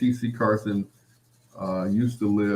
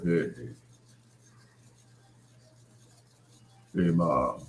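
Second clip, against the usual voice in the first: put-on low, deep, rumbling teen voice; 6 semitones lower, 5 dB quieter than usual. Teen son asking mom for grits in a new deep voice. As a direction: drop it low like a voice just broken. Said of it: "my voice changed".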